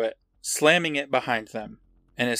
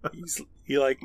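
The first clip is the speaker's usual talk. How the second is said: normal talking voice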